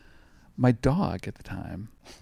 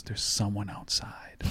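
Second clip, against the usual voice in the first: mother's ominous whisper; 3 semitones lower, 7 dB quieter, slower than usual. Retold or imitated. Terrified mother voiced in story.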